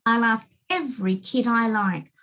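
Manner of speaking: put-on lively, bouncing children's rhyme; rhyming read-aloud. A narrator's reading. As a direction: read it like a bouncy picture book. Child narrator reading rhyming book.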